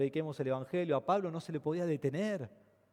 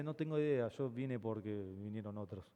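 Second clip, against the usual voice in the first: softer, lower shrug of a lost protester; clueless protester; 7 semitones lower, 9 dB quieter than usual. Retold or imitated. Protester answering a TV reporter, softer clueless shrug. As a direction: mumble it like you have no clue why you came.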